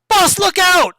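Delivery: high, loud shouted warning; warning yell. Acted. Kieran warning the boss of a claw, warning yell. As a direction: yell it sharply across a fight. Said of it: "Kieran yells".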